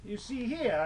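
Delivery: normal talking voice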